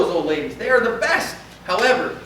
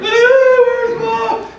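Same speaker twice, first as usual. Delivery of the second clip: much higher, loud panicked wail; lost child crying out; 10 dB louder, much slower than usual. Retold or imitated. Lost child at the mall wails, high and loud. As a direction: cry out like a scared little kid. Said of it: "you see a little one that's lost".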